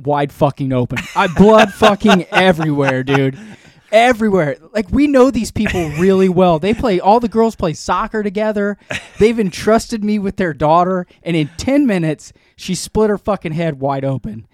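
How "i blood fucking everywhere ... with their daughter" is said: raised, louder storytelling rant; appalled comic outburst; exasperated horror. Retold or imitated. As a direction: relive the panic, pitched up and emphatic. Recounts guest's child badly hurt at house.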